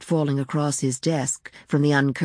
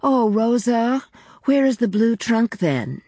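Baroness calling downstairs; 7 semitones higher than usual. A narrator's reading. Baroness calling the maid from the attic, high lilting call. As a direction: call lightly down the stairs to the maid. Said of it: "the Baroness's voice dipped like a swallow".

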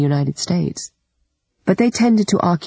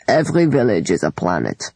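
toast voice; 6 semitones lower than usual. A narrator's reading. Lunacharsky's toast, glass raised.

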